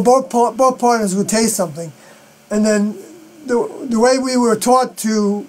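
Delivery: ordinary talk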